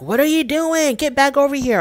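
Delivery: light impression of an angry husband shouting; retold yelling. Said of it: "he starts yelling at her".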